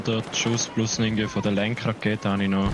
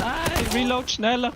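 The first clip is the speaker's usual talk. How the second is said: urgent; slower than usual. Real, not acted. Urges teammate during fight.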